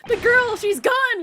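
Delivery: high, loud, drawn-out cry of alarm; slow panicked shout. Acted. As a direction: cry it out in shock. Acting alarmed court, girl vanished.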